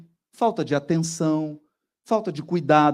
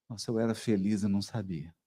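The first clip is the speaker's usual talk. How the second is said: mild lament; 8 semitones lower, 9 dB quieter, faster than usual. Retold or imitated. Pessoa lamenta a mudança.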